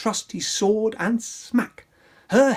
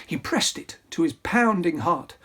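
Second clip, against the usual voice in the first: plain reading voice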